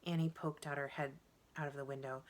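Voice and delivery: ordinary delivery, nothing marked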